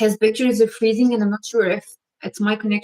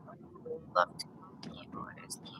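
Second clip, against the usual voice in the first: breathy secret whisper, barely audible; whispered aside; 21 dB quieter than usual. Real, not acted. Live host whispers a shy aside to her viewer, hushed whisper.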